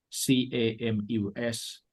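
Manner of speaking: ordinary talk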